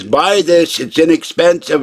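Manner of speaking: scornful imitation; mocking quote. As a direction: mimic the pushy suggestion. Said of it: "when someone says".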